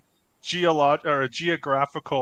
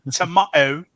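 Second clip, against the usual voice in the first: louder Cockney "tomato"; Cockney accent; 3 semitones higher, 7 dB louder than usual. Shown as a demonstration. Host showing co-hosts how Cockney says tomato, Cockney accent. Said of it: "which is a terrible Cockney accent".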